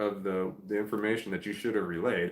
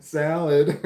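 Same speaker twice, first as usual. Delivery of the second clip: mock frustrated kid; 6 semitones higher, 7 dB louder, slower than usual. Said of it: "They're like".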